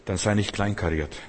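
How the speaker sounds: normal talking voice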